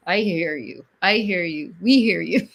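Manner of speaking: ordinary talk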